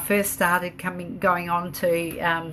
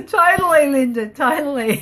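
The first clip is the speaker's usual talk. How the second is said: high, laughing agreement; giggly, pitched-up and emphatic; amused agreement; 6 semitones higher, 4 dB louder than usual. Real, not acted. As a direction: agree heartily through real laughter. Laughing along with a viewer.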